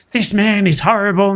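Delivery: high-pitched mock yell, no real volume; retold yell, pitched well up. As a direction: imitate an outraged customer making a scene. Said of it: "yelling in front of all of my customers".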